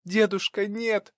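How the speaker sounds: granddaughter crying out to stop her grandfather; pleading cry. A narrator's reading. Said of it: "выкрикнула Маша". (отчаянный возглас внучки, высоким голосом)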